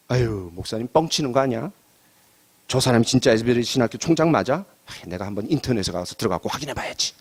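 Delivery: low, doubting grumble of an imagined listener; sceptical aside. Acted. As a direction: voice a doubter's aside, pitched well down. Voices a doubting listener's private reaction.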